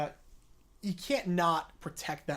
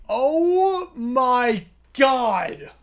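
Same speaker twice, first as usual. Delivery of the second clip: high, loud overjoyed screaming; ecstatic shout; 9 semitones higher, 12 dB louder, much slower than usual. Real, not acted. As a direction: scream with joy. Reacting to huge gift.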